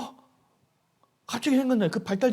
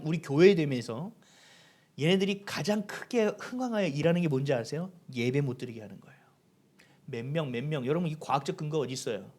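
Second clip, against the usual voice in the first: usual speaking voice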